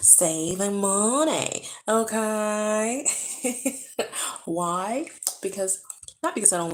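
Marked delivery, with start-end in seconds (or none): 0.1-3.1 drawn-out, rising sassy "okay"; sassy boast. Real, not acted. Vlogger bragging to viewers about saving, sassy and drawn out. 3.1-4.4 high, breathy chuckle; giggle. Real, not acted. Vlogger amused by her own boast, high giggle.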